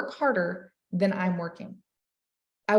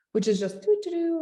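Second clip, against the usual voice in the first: mock idle humming; 8 semitones higher than usual. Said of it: "what I call cruise control".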